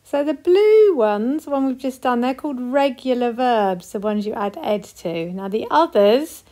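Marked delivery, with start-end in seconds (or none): 0.0-1.4 louder, slow, emphatic opening; emphatic teacher. Real, not acted. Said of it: "teacher stressing key point".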